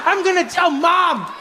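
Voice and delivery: put-on high-pitched wronged little boy; aggrieved kid. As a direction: whine an accusation at dad as a child. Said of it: "I know this kid was like".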